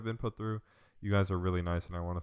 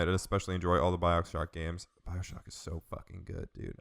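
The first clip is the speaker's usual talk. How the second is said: ordinary talk, nothing marked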